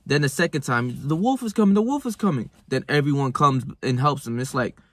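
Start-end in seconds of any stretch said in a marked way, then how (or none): 1.1-2.5 higher pitched, half-hearted fake panic; a retold kid's fake warning cry, pitched up; mock alarm call. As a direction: play the lying boy crying out for help. Boy who cried wolf calling out.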